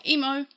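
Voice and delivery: emo said in her everyday Australian way, ee-moh. Shown as a demonstration. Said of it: "like I'm saying it right now".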